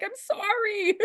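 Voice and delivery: mock apology.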